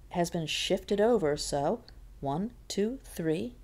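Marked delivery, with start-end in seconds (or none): none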